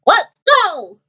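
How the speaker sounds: high quacking duck cry. Acted. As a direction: quack it out as a rallying call. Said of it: "cracked Ducky Lucky".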